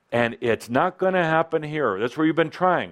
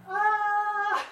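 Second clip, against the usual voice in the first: laughing. Real, not acted. Scream attempt turns into laugh.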